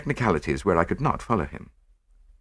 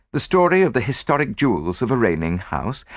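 ordinary delivery, nothing marked

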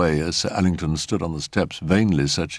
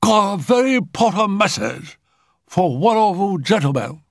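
high, otherworldly messenger voice; Shiramako; 14 semitones higher than usual. A narrator's reading. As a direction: pipe it out very high, like a séance spirit. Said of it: "announced Shiramako".

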